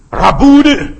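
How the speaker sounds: very loud, high, acted outcry; cry of recognition. Mary hearing Jesus call her name, loud overwhelmed cry. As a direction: cry out as if suddenly recognising him.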